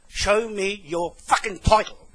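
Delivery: slow, emphatic sweary challenge; angry demand. Real, not acted. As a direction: drive each word home angrily.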